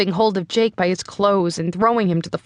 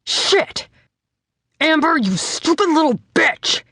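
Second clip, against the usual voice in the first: angry outburst, higher; a furious father snapping; an enraged dad at the table, cursing his daughter; growled; 8 semitones higher than usual. A narrator's reading. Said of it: "he growled".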